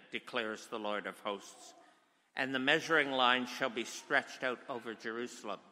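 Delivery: ordinary talk, nothing marked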